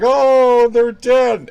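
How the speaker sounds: loud, high mock wail; hammed-up grief, wailed at the top; over-the-top crying. Actors mourning people dying, over-the-top crying. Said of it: "they were crying".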